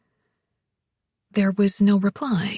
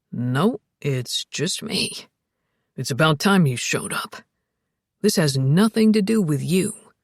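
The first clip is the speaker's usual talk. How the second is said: low, dry man's voice; sardonic Tom; 7 semitones lower than usual. A narrator's reading. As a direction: answer dryly in a deep voice.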